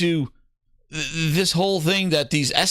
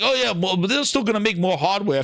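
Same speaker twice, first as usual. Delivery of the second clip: higher mock-defensive voice; sarcastic higher impression of him; mocking imitation; 6 semitones higher than usual. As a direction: parody the excuse, pitched up. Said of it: "he was like".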